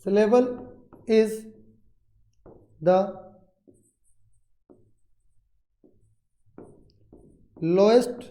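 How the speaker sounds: word by word with long pauses; dictation. Shown as a demonstration. Teacher has students write the syllable definition, slow dictation. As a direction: dictate slowly, leaving time to write each word. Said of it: "syllable के बारे में लिख लीजिएगा".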